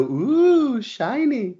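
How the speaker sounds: high, cooing mock admiration; mock awe. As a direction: coo like dazzled commenters. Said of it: "Everybody in the comments go".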